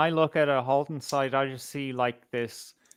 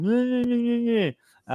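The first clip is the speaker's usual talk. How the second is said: high mocking nyeh nyeh; mock a childish whine; 10 semitones higher than usual. Mocking whining response.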